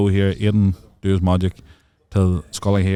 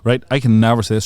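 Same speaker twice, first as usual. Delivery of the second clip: usual speaking voice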